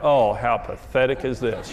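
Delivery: low, slow, unimpressed sigh of contempt; wife's scornful look put into words; withering disdain. Retold or imitated. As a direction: let her pity him in a low slow drawl. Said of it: "her looking at me like".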